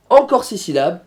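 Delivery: ordinary delivery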